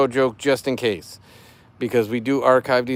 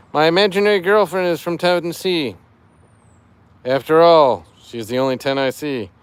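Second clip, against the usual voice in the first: dad joke voice; 4 semitones higher, 6 dB louder than usual. Acted. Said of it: "I'm doing it in dad joke voice".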